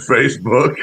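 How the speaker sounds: ordinary talk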